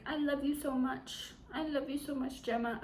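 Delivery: high, soft swooning declaration; mock lovesick pleading. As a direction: gush it softly, making fun of him. Mocking his sudden declarations of love.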